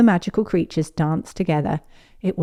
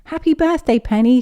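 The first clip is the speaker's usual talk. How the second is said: high, louder cheerful greeting; excited greeting; 7 semitones higher, 5 dB louder than usual. Acted. Pepper greeting Penny, high and excited. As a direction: burst in with birthday joy.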